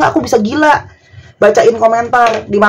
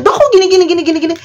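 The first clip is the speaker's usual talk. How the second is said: mock complaining client; 8 semitones higher, faster than usual. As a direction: mimic a nagging patient scolding the doctor. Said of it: "tapi kamu marahin dokternya". (pasien cerewet menirukan, suara tinggi merengek sambil tertawa)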